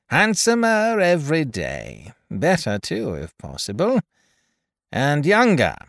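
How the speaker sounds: lightly voiced sly old gentleman; Tackleton. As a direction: greet them with sly, grudging flattery. Tackleton greets John and Dot.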